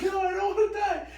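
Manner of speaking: put-on very high, loud protest of a scared kid; high child wail. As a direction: cry it out like a child refusing to die. Retold child protesting to mom.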